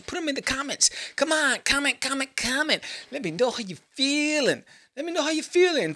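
high, pumped call to comment; excited high-pitched begging for comments; hyped plea. Real, not acted. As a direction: hype the viewers into commenting.

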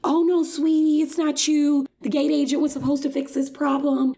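a flight attendant soothing a passenger, mock sugar-sweet; sugary placating attendant. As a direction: lay it on thick like a fake-nice flight attendant.

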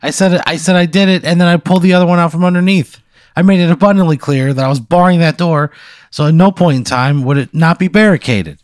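high-pitched, insistent objection; indignant protest. Real, not acted. Player disputing the game master over a barred door, indignant.

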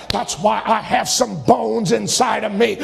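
ordinary delivery

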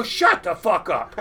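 slow laughing brush-off; slow, laughing retort. Retold or imitated. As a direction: tell the voice to shut up, half laughing. Answering the inner voice.

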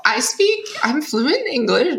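jokey overclaim; mock boast. Shown as a demonstration. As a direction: start a playful boast, high. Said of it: "can't say that even as a joke".

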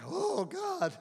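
very high, comic cry to God; mock desperate plea. Parent of high schoolers praying, mock desperation. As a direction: pitch it way up as a hammed-up prayer.

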